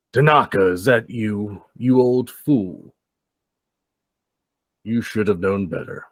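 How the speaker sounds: lightly acted taunting man; slow, drawn-out jab at an old acquaintance; man's voice through gate. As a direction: call out to an old rival. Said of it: "it's a man's voice".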